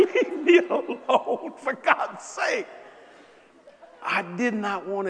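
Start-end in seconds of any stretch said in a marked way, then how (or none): 0.1-2.7 high, slow laughing plea; mock exasperated. Husband begging his wife to drop it, mock exasperated. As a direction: whine it in fake annoyance.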